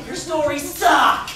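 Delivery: drawn out, loud and laughing; slow mocking shout. Rival storyteller jeering at the others, slow laughing yell. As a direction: drag it out and yell it through laughter.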